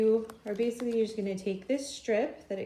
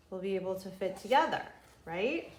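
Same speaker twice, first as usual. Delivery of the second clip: usual speaking voice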